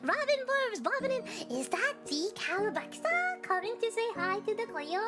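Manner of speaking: high, bubbly hello to chat; excited greeting. Acted. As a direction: greet them like an eager fan.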